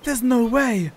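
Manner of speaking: loud, high cry of disbelief; outraged disbelief. Real, not acted. Reacting to loot being swiped.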